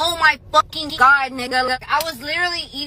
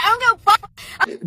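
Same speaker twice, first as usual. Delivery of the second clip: retold yell; 7 semitones higher, much faster than usual. Retold defiant reply.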